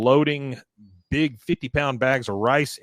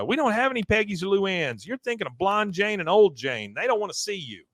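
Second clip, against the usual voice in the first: higher, prim voice from behind the gate; cult member voice; 7 semitones higher than usual. Retold or imitated. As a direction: brush him off in a higher, prissy voice. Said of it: "you hear".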